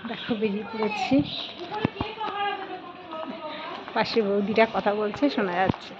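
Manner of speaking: soft, shy voice, kept low in the street; speaking softly. Real, not acted. A shy mother walking past neighbours, speaking softly. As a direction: keep it soft so the neighbours can't hear. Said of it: "আস্তে আস্তে বলছি তো".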